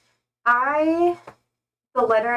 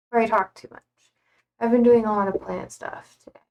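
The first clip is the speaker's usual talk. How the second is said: usual speaking voice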